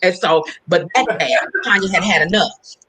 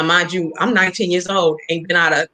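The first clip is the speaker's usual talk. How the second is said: ordinary delivery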